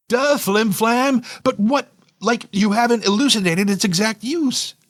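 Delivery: high-pitched, impatient "duh" reply; exasperated retort. A narrator's reading. Narrator pressing Meg on the thing's use, exasperated. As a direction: push the voice up high in protest.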